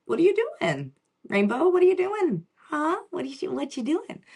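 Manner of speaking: put-on mother asking her digging child what she's doing; imitating mom. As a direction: play the puzzled mother asking her kid again and again. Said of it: "she's like".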